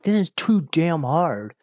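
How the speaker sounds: acted-out frustrated gamer; mock pissed off. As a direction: whine in annoyance at a purchase. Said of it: "really pissed off".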